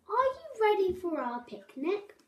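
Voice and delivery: high, eager animal voice; cheery cry. A narrator's reading. Squirrel and Mouse invite Babbitty out, cheery cry. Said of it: "cried Squirrel and Mouse".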